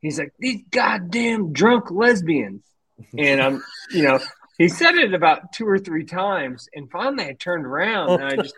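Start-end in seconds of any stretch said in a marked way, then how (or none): 0.4-2.6 drawn-out mock holler of an old fisherman, slow; slow, hammed-up grumbling yell; imitating an old Kentucky angler yelling slowly; mock yelling old guy. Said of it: "I just keep hearing him yelling".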